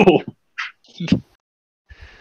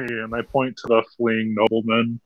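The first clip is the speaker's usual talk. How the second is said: ordinary talk, nothing marked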